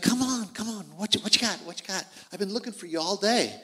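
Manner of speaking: put-on a dad thrilled his kid finally came, fast and bright; eager dad. Father welcoming the guilty child in, eager and high.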